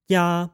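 ya held high and level in Mandarin first tone. Shown as a demonstration. Teacher models ya in first tone for learners. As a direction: sing ya on one high flat pitch. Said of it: "and with the first tone".

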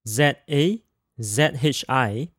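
usual speaking voice

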